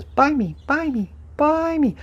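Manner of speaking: a tiny toy pleading to be bought, way up high; talking gadget voice. Pretend. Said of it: "It says".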